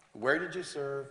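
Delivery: ordinary delivery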